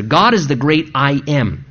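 ordinary talk, nothing marked

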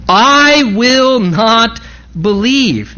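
slow, each word set down: I will not believe; stubborn Thomas. Acted. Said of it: "Thomas pretty stubbornly says here".

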